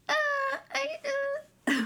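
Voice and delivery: high stammering mock whine; a hammed-up whimpering bride. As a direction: fumble for words in a high fake whimper. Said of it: "she just kinda was like".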